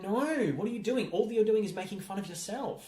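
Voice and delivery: high-pitched protest at a silly choice; exasperated objection. Retold or imitated. Fan scolding the film for mocking itself, exasperated objection.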